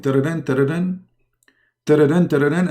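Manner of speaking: sung phone ring imitation; phone ringtone. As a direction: hum a ringing phone tune. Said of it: "he call him".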